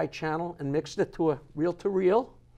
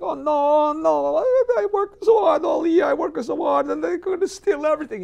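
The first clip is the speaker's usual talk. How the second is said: put-on high, loud, pleading Italian accent; Italian farmer accent; 10 semitones higher, 8 dB louder than usual. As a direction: beg the bandits in a hammy Italian accent. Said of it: "There's an Italian farmer".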